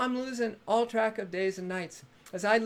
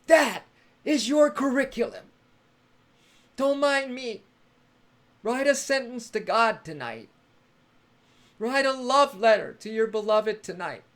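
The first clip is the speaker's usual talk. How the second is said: loud exhortation; 3 semitones higher, 8 dB louder than usual. Real, not acted. Host loudly assigning viewers a love letter to God. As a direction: proclaim the homework like a sermon.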